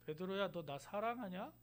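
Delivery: soft, quiet asking; tender question. Acted. Jesus quietly asks Peter if he loves him, tender. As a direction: ask gently with love. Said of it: "그거 물으시잖아요".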